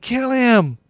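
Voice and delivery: high shouted cry of the mob; angry crowd shouting at the governor. A narrator's reading. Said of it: "with one voice they shouted".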